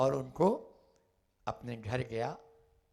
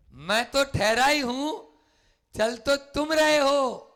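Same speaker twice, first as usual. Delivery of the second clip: higher, louder, slower retort; calm words raised loud; 12 semitones higher, 9 dB louder than usual. Shown as a demonstration. Preacher repeating the Buddha's reply loudly. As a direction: repeat the line booming and slow.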